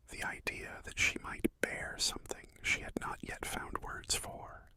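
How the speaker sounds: ordinary delivery, nothing marked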